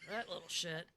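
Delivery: ordinary talk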